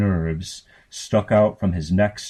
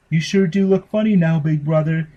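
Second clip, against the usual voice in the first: put-on much higher, louder little girl voice; high giggly child's taunt; 9 semitones higher, 4 dB louder than usual. Little sister teasing her headless brother, high childish voice. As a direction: tease him in a high sing-song child voice.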